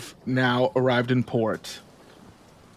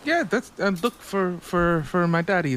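put-on high, halting child voice; little lost kid; 5 semitones higher than usual. Child tells the crew who they seek, high little-kid voice. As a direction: stumble through it like a small child.

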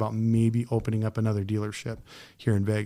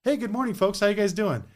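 high, bright salesman hello; cheery greeting; 8 semitones higher than usual. Retold or imitated. Dealer greeting arriving customers with a hammed-up cheerful hello. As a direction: greet them like a sunny car salesman.

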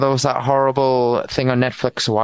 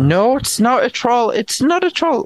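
indignant outburst; 12 semitones higher, 3 dB louder than usual. Real, not acted. Rejecting a friend's theory, high and indignant. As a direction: pitch leaps much higher, louder, exasperated.

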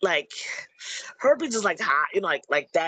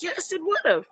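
high-pitched mock exasperation; exasperated retort; 12 semitones higher than usual. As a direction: answer imagined nitpickers, voice jumping up. Acted retort to nitpicking viewers.

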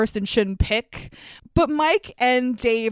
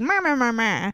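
childish singsong mocking the mom; nyah-nyah imitation of nagging; mocking meh meh.

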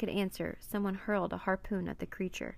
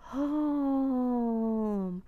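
high, long mournful call, slowly falling; unbearably sad wail; 5 semitones higher, 4 dB louder than usual. A narrator's reading. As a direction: moan it from the heart of the ocean. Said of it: "The sound was unbearably sad".

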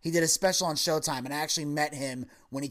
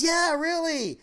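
much higher, eager mock yes; mock eager reassurance; 12 semitones higher, slower than usual. Husband reassuring his wife on the plane, mock eager. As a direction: oversell it to her, a light imitation. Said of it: "He goes".

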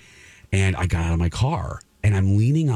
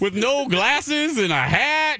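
incredulous; slower than usual. Retold or imitated. Incredulous question to Tom.

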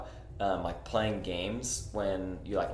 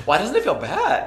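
laughing through the words, high; 12 semitones higher, 7 dB louder than usual. Real, not acted. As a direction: giggle as you speak.

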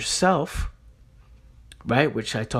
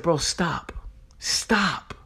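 firm stop; 3 semitones higher, much slower than usual. Retold or imitated. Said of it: "I said".

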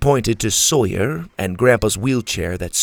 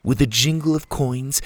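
ordinary delivery

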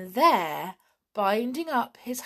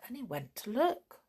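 plain reading voice

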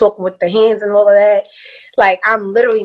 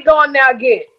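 Southern drawl; 3 semitones higher than usual. Retold or imitated. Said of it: "I tell everybody".

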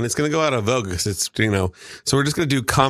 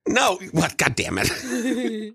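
high, drawn-out exasperated groan; slow, amused frustration; laughing exasperation; 9 semitones higher, much slower than usual. Real, not acted. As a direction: give up, half laughing. Exasperated by friends' wrong guesses.

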